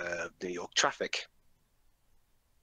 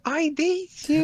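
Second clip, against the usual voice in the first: very high, slow swooning line; mock falsetto plea; 5 dB louder, slower than usual. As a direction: squeal it like a lovesick hero.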